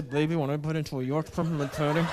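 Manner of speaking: words through clenched teeth; sexy talk through locked jaw. Shown as a demonstration. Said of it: "talk through clenched teeth".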